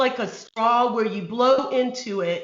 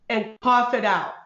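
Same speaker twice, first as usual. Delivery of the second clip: ordinary talk, nothing marked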